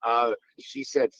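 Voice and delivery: ordinary delivery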